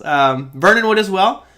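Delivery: normal talking voice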